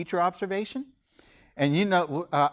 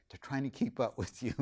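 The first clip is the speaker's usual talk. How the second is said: quiet tip; 2 semitones lower, 10 dB quieter than usual. Retold or imitated. Co-teacher's quiet tip, retold.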